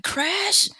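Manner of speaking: high, rising disbelief; surprised. Retold or imitated. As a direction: blurt out in surprise. Surprised recall.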